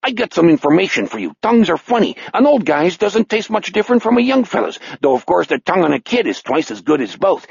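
chatty, gloating Chicago killer; menacing Chicago patter. Acted. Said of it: "In a Chicago voice, he said".

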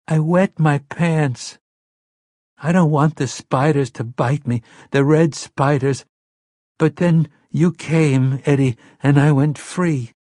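put-on a scared boy telling his rescuer he's free; relieved little boy. King as seven-year-old boy.

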